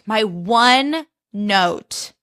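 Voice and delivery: very slow, each word drawn out; dragging the words out to make a point; slow emphatic. Shown as a demonstration. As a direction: stretch my one note out for weight.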